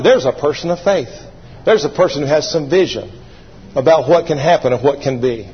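usual speaking voice

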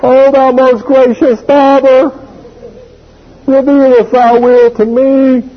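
sanctimonious son stalling, high and slow; mock pious plea. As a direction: pretend piety to dodge chores.

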